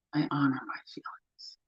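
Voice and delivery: soft whisper, almost breath; whispered affirmation. Acted. Tapping coach closing affirmations, whispered. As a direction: hush it like a private vow.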